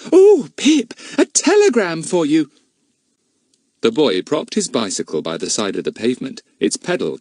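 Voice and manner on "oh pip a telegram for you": put-on little girl. A narrator's reading. Betts speaks.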